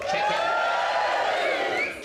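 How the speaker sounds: mock squeal. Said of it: "boast about movie".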